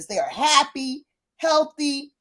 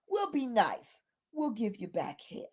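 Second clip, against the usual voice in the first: much softer, understated offer; calm concession; 11 dB quieter than usual. Retold or imitated. Neighbor offers buyer half the deposit, soft and calm. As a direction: imitate quiet magnanimity.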